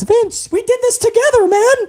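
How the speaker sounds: whiny son-in-law answers the chairman; mock son-in-law. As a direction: plead back to the boss. Voicing the son-in-law replying.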